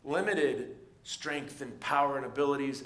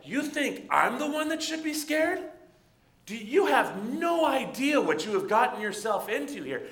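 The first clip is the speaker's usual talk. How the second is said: much higher, louder, disbelieving; incredulous defiance; 8 semitones higher, 5 dB louder than usual. Retold or imitated. Shepherd boy answering the giant, high and disbelieving. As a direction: throw the threat back at him in disbelief. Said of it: "David is almost like".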